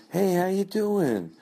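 high, slow, sugary host greeting; fake party host. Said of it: "I started to say it like".

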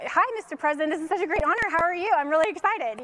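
high, gushing excitement; fangirling. Retold or imitated. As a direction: re-enact a starstruck fan greeting an idol. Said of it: "totally fangirling".

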